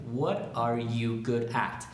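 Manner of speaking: slow, evenly separated words; slow model question. Shown as a demonstration. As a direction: give each word its own space.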